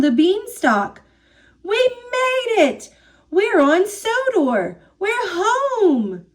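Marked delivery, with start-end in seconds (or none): 1.6-6.3 high, elated cheer of relief; joyful cry. A narrator's reading. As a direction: cry out happily, pitched well up. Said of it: "Thomas cried".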